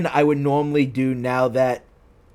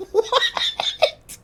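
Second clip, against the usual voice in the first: ordinary talk, nothing marked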